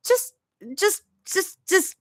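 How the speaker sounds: high, stumbling angry hiss; angry mutter. A narrator's reading. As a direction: sputter in frustration. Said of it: "Zoe mutters, an angry hiss".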